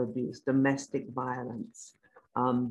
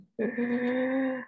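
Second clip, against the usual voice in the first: embarrassed giggle; quick high laugh; high bashful laugh; 10 semitones higher than usual. Real, not acted. Laughs at compliment.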